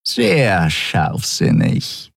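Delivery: very slow, silky purr; purring sarcasm. A narrator's reading. Traitor purring sarcastically close to the admiral's ear. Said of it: "schnurrte Shiar dicht neben seinem Ohr".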